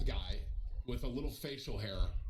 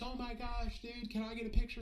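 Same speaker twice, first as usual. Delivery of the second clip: lightly imitated starstruck fan, higher pitched; mock fan excitement, pitched up; excited fan; 11 semitones higher than usual. Typical fan asking streamer for a picture, mildly excited. Retelling a typical fan's reaction.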